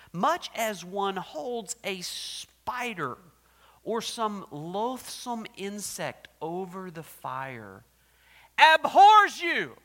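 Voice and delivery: slow, building to a huge roar on abhors; Edwards' sermon. Retold or imitated. Preacher quoting Edwards' sermon, building to a roar. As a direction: thunder the old sermon, ending in a roar. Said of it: "He said".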